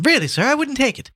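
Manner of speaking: high-pitched insistent refusal; protesting cry. A narrator's reading. Girl refusing Gabriel's coin, high protesting cry. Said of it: "cried the girl, following him".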